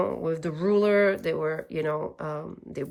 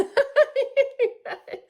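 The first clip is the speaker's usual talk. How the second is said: high embarrassed laugh. Real, not acted. High laugh after getting emotional.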